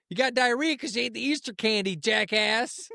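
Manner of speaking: scolding woman, high-pitched put-on voice; high mock scolding; imitating Kelly. Kelly telling off the dog, high scolding imitation. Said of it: "Kelly seen him and she's like".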